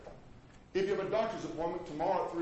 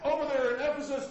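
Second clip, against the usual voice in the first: ordinary delivery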